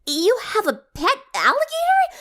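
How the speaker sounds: high, stammering disbelief; astonished. A narrator's reading. Twilight seeing Pinkie's alligator, stammering in disbelief. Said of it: "jaw nearly hit the ground".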